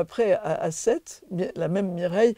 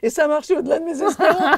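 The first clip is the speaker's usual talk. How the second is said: high, loud laughing delivery; delighted laughter breaking through the words; 9 semitones higher, 8 dB louder, faster than usual. Real, not acted. Laughing at her own prank.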